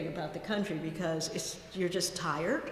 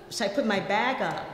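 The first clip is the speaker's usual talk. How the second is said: ordinary talk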